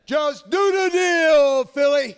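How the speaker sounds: slow, very high, loud bellow; catchphrase shout. Acted. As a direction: roar the sign-off at the whole city. Asked for the catchphrase sign-off.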